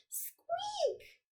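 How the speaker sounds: very high whispered squeak; tiny high squeak; hamster squeak. Shown as a demonstration. Hamster sound in pets book.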